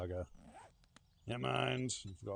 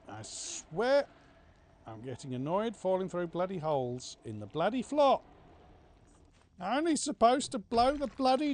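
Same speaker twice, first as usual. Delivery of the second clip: loud, higher, exasperated ranting; frustrated rant; 8 semitones higher, 7 dB louder than usual. Real, not acted. Player falling through holes again, loud frustrated rant. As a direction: let the annoyance boil over.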